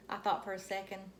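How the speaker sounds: usual speaking voice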